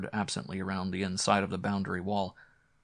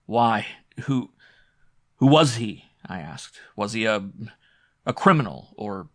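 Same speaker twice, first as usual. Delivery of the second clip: faltering; 6 dB louder than usual. A narrator's reading. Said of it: "My tongue faltered".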